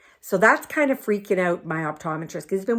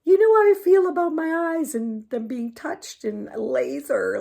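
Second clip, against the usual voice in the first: anxious prayer; 10 semitones higher, 4 dB louder than usual. Retold or imitated. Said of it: "But I was like".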